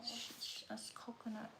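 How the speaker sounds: soft unvoiced shush; quiet shushing; shushing whisper. Real, not acted. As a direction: hush her gently. Hushing the child.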